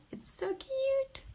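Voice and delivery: very high squeal on cute. Real, not acted. Streamer sees dogs and kitty on screen, high squeal. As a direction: melt at the sight of the pets.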